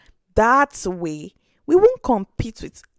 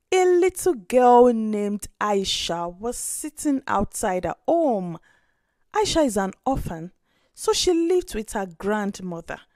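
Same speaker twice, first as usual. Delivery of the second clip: ordinary delivery, nothing marked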